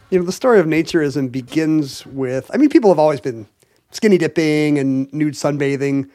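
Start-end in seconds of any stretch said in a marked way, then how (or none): none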